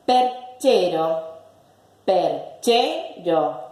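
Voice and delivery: slow, separated "Perchero", che stressed. Shown as a demonstration. Teacher asking the word's class, "Perchero" split with stress on che. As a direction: break "Perchero" into per, CHE, ro.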